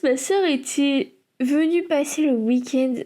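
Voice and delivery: ordinary talk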